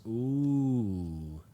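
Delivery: long, drawn-out mocking "ooh"; condescending. Hirohiko's head interrupting her, condescending. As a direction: look down on them with one sliding vowel. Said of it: "condescending tone you could probably imagine".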